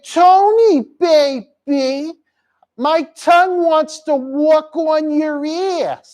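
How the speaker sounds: high, slow seductress impression; Annie. Host as Annie coming on to Tony, high slow impression. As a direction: push it up high and stretch each word. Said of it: "Annie would say".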